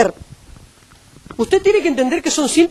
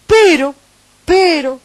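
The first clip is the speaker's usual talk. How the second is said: "Pero" hit loud and high, twice; loud emphatic "Pero"; 5 semitones higher, 6 dB louder, much slower than usual. Real, not acted. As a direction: punch both "pero" to turn the point. Preacher's emphatic turn. ("Pero" fuerte y agudo, dos veces)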